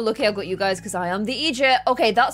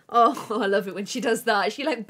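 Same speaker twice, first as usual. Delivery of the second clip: ordinary talk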